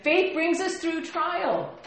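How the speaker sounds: high, loud, slow proclamation; emphatic. Shown as a demonstration. Pastor correcting himself, slow loud proclamation. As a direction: proclaim it slowly and strongly. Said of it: "I did say that, didn't I?".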